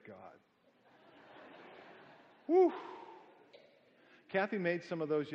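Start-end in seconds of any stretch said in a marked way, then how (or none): 2.5-2.7 joyful whoop. Real, not acted. Delight over buttery cinnamon rolls.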